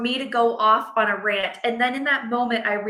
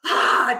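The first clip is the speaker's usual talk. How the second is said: frustrated yell; 4 dB louder than usual. Retold or imitated. Frustrated outburst at daughter.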